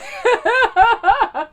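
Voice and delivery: loud, high cackle; real laughter. Real, not acted. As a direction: crack up loudly at your own joke. Laughing at own imitation.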